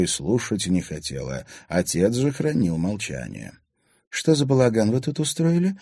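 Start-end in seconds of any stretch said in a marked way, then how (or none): none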